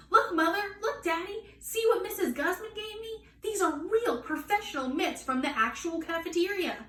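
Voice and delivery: put-on high, eager child voice; bursting to show off her new mitts; little girl shouting to her parents; excited show-off. Junie B. showing off new mitts.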